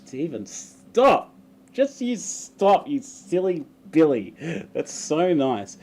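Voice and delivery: coy, slowed-down flirty scolding; mock flustered. Reading a cheeky bus comment, mock bashful scolding. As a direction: play-act blushing coyness.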